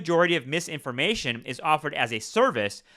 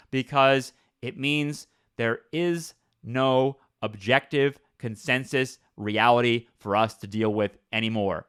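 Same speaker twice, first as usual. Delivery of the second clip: usual speaking voice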